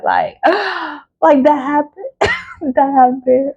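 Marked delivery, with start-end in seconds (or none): 0.4-3.5 gleeful mock gasp. Mock gasp of glee, much higher.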